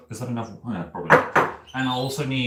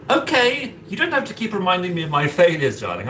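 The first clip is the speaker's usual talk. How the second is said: mock offended; 6 semitones higher, 3 dB louder than usual. Mock-offended reply to chat.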